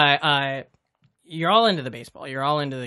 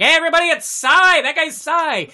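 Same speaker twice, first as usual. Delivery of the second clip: high, loud call to the whole party; mock-announce it to the room, loud and high; retold shout; 12 semitones higher, 6 dB louder than usual. Outing the mascot at party.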